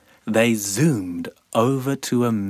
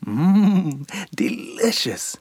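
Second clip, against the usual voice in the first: high hum then whispered delicious; sandfly whisper; 7 semitones higher than usual. Acted. Sandfly savoring skin, high then whispered.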